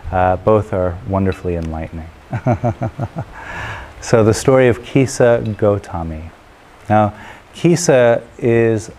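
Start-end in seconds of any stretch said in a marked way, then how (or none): 2.3-4.0 light rippling chuckle. Real, not acted. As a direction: chuckle at your own remark. Amused at own aside.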